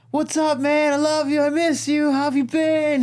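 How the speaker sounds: very high, gushing mock affection; hammed-up, sustained high-pitched greeting; super over-exaggerated. Kid distracting his dad so friend escapes, wildly over-exaggerated. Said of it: "super over-exaggerated".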